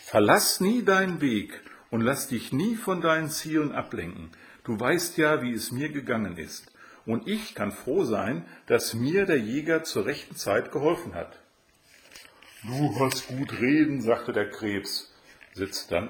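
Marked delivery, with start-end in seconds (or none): 0.0-11.4 put-on high, encouraging storybook girl; Rotkäppchen. A narrator's reading. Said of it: "das ihn mit den Worten aufmunterte". 12.6-14.0 higher-pitched retort from a storybook crab; grumbling crab. A narrator's reading.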